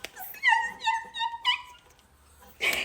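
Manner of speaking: high, giddy yes yes yes; inner excited squeal. Retold or imitated. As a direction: squeal with secret delight. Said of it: "Inside of me was screaming like".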